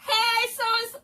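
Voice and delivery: high, loud friendly greeting; greeting Kurt now that she can see, high and loud; eager hail. Retold or imitated. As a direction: call out to an old friend, delighted. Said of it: "I can hail you like".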